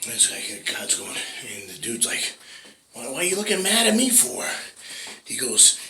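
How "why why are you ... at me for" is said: very high, confused plea; bewildered man. Acted. Dead man asking an angry God why he's mad, very high and bewildered. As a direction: ask why he's in trouble.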